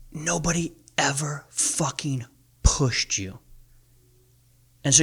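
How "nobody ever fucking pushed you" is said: every word spaced out; slow, pointed confrontation. Acted. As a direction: drive it home slowly, word by word. Confronting the men he coaches.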